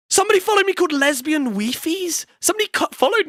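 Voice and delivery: high-pitched amazed announcement; incredulous. Real, not acted. Streamer reading out an odd username, incredulous. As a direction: read it out in rising disbelief.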